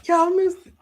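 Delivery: very high, loud exclamation; high-pitched pulpit shout; excited preacher's cry. Real, not acted. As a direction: cry it out to wake the congregation.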